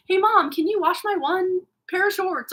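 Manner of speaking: lightly voiced kid asking Mom; high whiny kid request; imitating kid. Voicing child asking mom.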